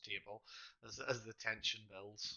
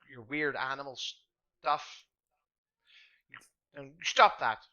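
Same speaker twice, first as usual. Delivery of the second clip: louder and higher, building to a shout; angry boy's scolding; 4 semitones higher, 8 dB louder than usual. Acted. Pip scolds the smelly man, rising to a loud shout. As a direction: tell him off like an indignant kid.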